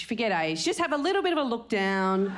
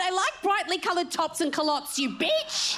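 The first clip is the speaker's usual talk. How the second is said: lightly voiced sassy heckler, a bit higher; mock heckler; 9 semitones higher than usual. Heckler sassing the comedian, mock sassy voice. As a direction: snap back like a sassy audience member.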